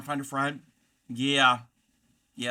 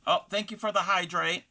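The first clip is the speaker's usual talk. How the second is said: ordinary delivery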